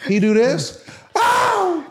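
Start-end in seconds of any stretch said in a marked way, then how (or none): 1.1-1.9 high hammed-up child's yell; mock kid yell. Retelling the kid yelling down the airplane, high mock yell. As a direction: yelp like a kid tumbling. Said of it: "He yelled down".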